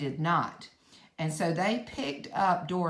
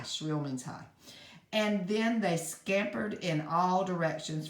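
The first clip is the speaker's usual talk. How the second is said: ordinary delivery, nothing marked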